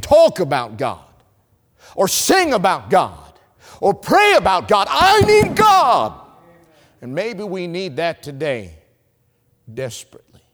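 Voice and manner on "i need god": preaching cry. Real, not acted. Preaching climax.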